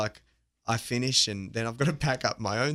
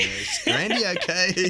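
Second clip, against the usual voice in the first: mock worried caller; 9 semitones higher, 7 dB louder, slower than usual. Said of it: "this funny accent".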